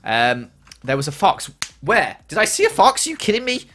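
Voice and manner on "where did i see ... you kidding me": high, loud, incredulous outburst; startled disbelief. Real, not acted. Streamer told a fox was there, loud startled disbelief. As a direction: blurt it out, voice jumping up.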